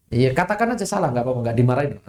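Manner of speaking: ordinary delivery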